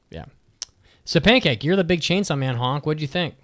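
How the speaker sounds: usual speaking voice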